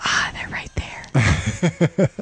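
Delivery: slow, low whisper. Retold or imitated. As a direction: whisper it lazily like a bored clerk. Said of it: "And him to go".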